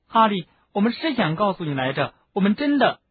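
high, pleading insistence; anxious, pressing explanation; urgent, earnest. A narrator's reading. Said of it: "赫敏急切地说".

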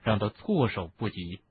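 ordinary delivery, nothing marked

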